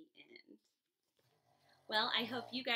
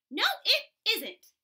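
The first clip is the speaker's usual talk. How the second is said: higher, louder cross snap; snapping; 8 semitones higher, 10 dB louder than usual. Acted. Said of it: "snapped Shelley".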